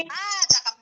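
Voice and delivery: ordinary talk, nothing marked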